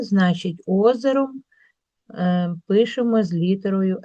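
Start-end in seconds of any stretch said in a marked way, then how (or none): none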